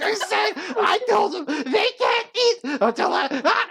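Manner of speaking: very high, strangled shrieking; thrashing meltdown. Acted. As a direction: scream while being dragged away. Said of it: "thrashing out".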